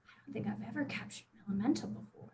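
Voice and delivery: very soft, quick murmur; musing to oneself. Acted. She thinks about capturing an elemental, quiet musing. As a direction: think aloud, hushed and fast. Character thinks to herself in story.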